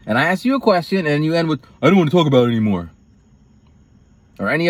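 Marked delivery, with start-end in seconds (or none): 1.8-2.9 dismissive mimicry.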